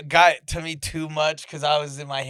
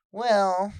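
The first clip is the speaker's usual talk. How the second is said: higher, put-on pedantic nerd voice; know-it-all nerd; 6 semitones higher, 5 dB louder than usual. Reddit nerd butting into the conversation, pedantic. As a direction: pipe up like an online nerd correcting someone. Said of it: "Reddit nerds are".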